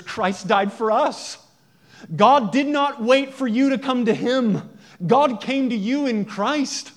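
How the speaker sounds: loud, high, impassioned preaching; full-force preaching. Real, not acted. Preacher proclaiming grace to the congregation, loud and high. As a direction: proclaim it to the whole congregation at the top of your voice.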